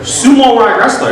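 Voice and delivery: high, relished "sumo-rye wrestler"; botched word, savored. Retold or imitated. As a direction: muse slowly on "sumo" said as sumo-rye. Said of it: "he fucked it up a bit".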